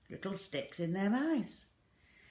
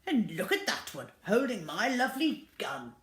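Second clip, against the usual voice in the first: shouting; 6 semitones higher, 7 dB louder than usual. Acted. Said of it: "shouted Mister Gregg".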